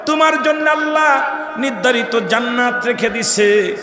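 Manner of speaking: very high, loud chanted cries; full-force preaching. Acted. Preacher voicing the saint's praise of his disciple, full-force preaching. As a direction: belt out each phrase, stretching the endings.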